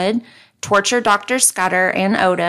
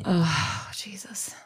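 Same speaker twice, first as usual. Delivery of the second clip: whisper; 10 dB quieter, much slower than usual. Real, not acted. Shocked whisper.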